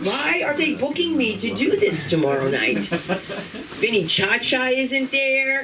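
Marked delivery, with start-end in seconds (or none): 2.8-5.6 slow drawn-out. Acted. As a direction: draw out "Vinny Cha-Cha isn't there" very slowly for effect. Joking about missing Sopranos crowd.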